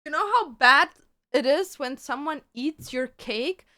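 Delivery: loud, indignant rant. Real, not acted. Telling viewers about her eaten cake, loud and indignant. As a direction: complain at full volume.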